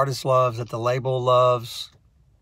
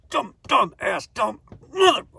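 higher, strangled self-scolding; self-scolding squeak; 9 semitones higher than usual. Retold or imitated. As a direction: scold yourself in a tight squeak. Self-scolding after falling.

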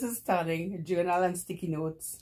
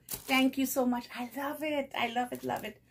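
high-pitched, gushing delight; delighted thanks; 8 semitones higher than usual. Real, not acted. Vlogger thanking a friend for gifts, delighted. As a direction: gush in a high voice.

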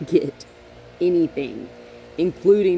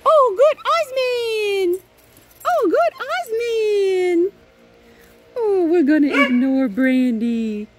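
scary enthusiasm; 12 semitones higher, 5 dB louder than usual. Real, not acted. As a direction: gush at the dog, over the top. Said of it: "My enthusiasm is scary".

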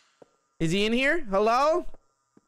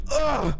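dying groan; 6 semitones higher than usual. Acted. Character dying in game.

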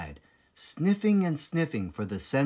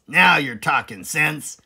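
loud, higher farmer voice, eager; Boggis; 4 semitones higher, 7 dB louder than usual. Acted. Boggis agreeing with Bean, loud eager farmer. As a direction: pounce on the idea eagerly. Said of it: "said Boggis".

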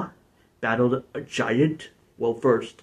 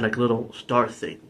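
normal talking voice

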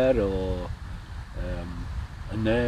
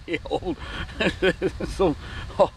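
laughing while telling; 7 semitones higher, slower than usual. Real, not acted. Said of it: "I couldn't stop fucking laughing".

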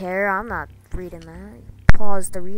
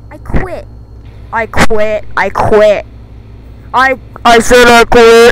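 louder and louder quitting shouts; yelling I quit; 20 dB louder, slower than usual. Real, not acted. Fed-up gamer screaming at the game that he quits. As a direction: scream it like you're done for good.

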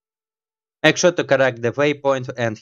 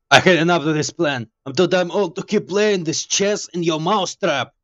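raised-pitch character complaining; game character voice; 5 semitones higher than usual. Acted. Game hero protesting the plan, higher character voice. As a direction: act out a fed-up character, pitched up.

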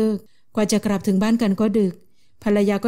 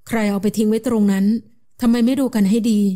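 usual speaking voice

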